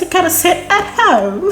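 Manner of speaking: high, playful mock British accent, laughing. Shown as a demonstration. A Nigerian mum mocking herself in a British accent. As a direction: put on a British accent for a joke. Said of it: "Let me say it in a British voice".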